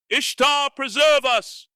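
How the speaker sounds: very high, slow shriek; terrified shriek. Acted. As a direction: shriek out in terror. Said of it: "shrieked Strabonus".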